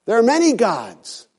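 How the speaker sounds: pressing the point up. Acted. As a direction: lift it on the echo. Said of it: "repeated for emphasis".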